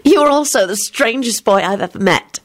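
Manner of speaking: put-on girlish declaration; declaring. A narrator's reading. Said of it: "she declared".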